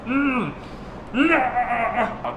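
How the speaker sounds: high, satisfied "mm" into laughing "ah"; high-pitched groan of delight; relishing moan and laugh. Real, not acted. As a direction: savour it with a gleeful, high "ahh". Relishing the feel of the handle.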